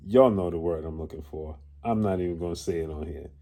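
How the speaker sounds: low, softened aside; coy aside. Real, not acted. Commentator refusing to say the word on here, coy aside. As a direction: drop it right down, knowingly holding back. Coyly withholding a loaded word.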